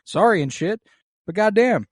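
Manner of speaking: high, fast, fed-up dude voice; king refusing the resignation, hammed up high; exasperated bro king. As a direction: rant it like an annoyed buddy. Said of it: "And Viserys is like".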